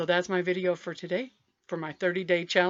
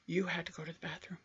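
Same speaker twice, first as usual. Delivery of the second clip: hushed, fast whisper; 3 semitones lower, 13 dB quieter, faster than usual. Retold or imitated. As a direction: whisper it like a shameful secret. Secret urgent confession in story.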